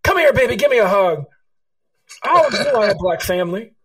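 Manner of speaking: put-on high, loud, gushing old woman's welcome; erupting with joy. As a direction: burst out as a delighted old lady pulling him into a hug. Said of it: "she just erupts into".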